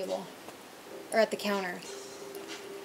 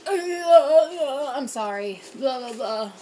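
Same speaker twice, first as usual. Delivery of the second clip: put-on stammering drunk; 4 semitones higher, 7 dB louder, slower than usual. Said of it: "he was just like".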